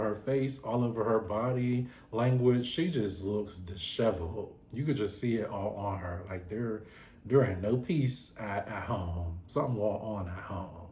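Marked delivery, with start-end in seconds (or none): none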